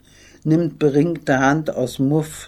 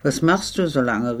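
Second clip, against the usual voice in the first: ordinary delivery, nothing marked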